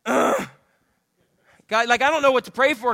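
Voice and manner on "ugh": demonstrated prayer groan; wordless groan. Shown as a demonstration. Pastor showing the congregation a wordless prayer, groaning. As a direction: groan it out without words. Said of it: "the best prayer is this".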